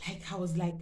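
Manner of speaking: ordinary delivery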